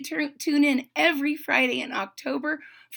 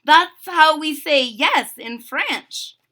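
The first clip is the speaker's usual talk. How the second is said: high, loud, slow exclamation; excited cry; 5 semitones higher, 9 dB louder, slower than usual. A narrator's reading. Gigi explaining her French word, crying out excitedly. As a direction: draw it out high and proud. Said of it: "Gigi cried".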